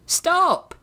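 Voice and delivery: high-pitched acted yell. A narrator's reading. A voice calling from the hubbub to halt fighting, yelled. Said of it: "yelled a voice from the hubbub".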